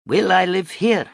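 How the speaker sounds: high, loud, eager rich woman; hopeful question. A narrator's reading. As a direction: ask it high and full of expectation. Rich woman hopes for palace.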